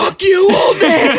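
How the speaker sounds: playful fake yell at the old man; mock shout. Blurting the imagined retort at stranger.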